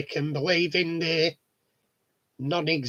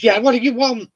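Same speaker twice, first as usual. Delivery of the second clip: brusque neighbour; 6 semitones higher, 9 dB louder than usual. Retold or imitated. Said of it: "he's brusque".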